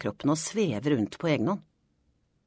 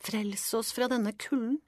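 high, soft, slow plea; drawn-out, high-pitched supplication; pleading; 8 semitones higher, 5 dB quieter, slower than usual. A narrator's reading. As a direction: beg faintly, like frozen bushes praying. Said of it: "strekker seg liksom bedende".

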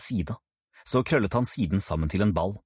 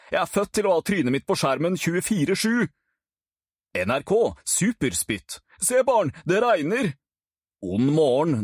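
put-on brash show-off boy, a bit higher; boastful Kent; 8 semitones higher than usual. Cocky Kent boasting to Ruben about becoming famous. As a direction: brag like a would-be celebrity. Kent pitching wild TV ideas.

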